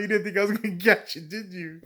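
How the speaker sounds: high, quick retold taunt; mock gloating. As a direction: rub it in as the triumphant friend. Friend gloats over catching him.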